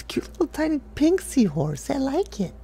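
cutesy gushing. Acted. Gushing over cute seahorse.

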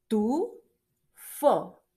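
Tufo split into TU, long pause, FO; teacher slowly sounding out both syllables of "Tufo". Shown as a demonstration. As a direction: break "Tufo" into two separate beats. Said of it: "tiene dos sílabas esa palabra".

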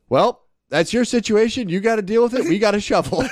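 high-pitched, unsympathetic brush-off; mock dismissive boss. Cousin brushes off his brother's crash call, mock dismissive. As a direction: imitate a boss refusing to help. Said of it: "Ajax pauses and goes".

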